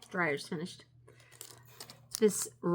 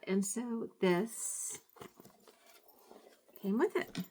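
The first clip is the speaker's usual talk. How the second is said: normal talking voice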